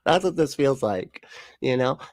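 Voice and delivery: normal talking voice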